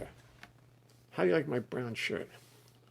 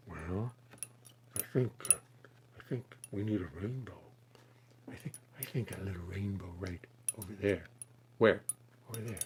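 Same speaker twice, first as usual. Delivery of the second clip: much softer, hushed painter's murmur; Bob Ross voice; 4 semitones lower, 12 dB quieter, faster than usual. Pretend. Asked for Bob Ross, answers in a soft hushed painter voice. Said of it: "do you like being Bob Ross?".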